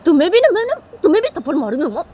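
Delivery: high, quick motherly warning; acted cross mother; scolding threat. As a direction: threaten a slap, exasperated.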